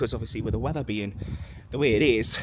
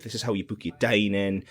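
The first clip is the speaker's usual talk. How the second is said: ordinary talk, nothing marked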